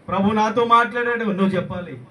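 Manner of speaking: high-pitched, forceful declaration; loud proclamation. Acted. Preacher urging believers to testify, loud proclamation.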